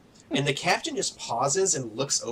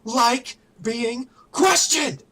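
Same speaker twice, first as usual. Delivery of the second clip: high, loud enraged shout; slow, punched-out angry roar; furious yell; 10 semitones higher, 4 dB louder, slower than usual. Acted. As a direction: yell it at an underling, stretching the last words. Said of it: "as he just yells".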